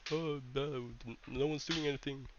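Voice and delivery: high, soft mock despair; whiny mock complaint; mock doomer. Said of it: "so I don't wanna make it sound like".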